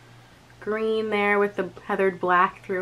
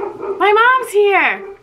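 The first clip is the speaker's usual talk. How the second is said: loud, high, drawn-out announcement; excited call; 9 semitones higher, 6 dB louder, much slower than usual. Real, not acted. Vlogger announces that mom's here, calling out excitedly. Announcing her mother's arrival.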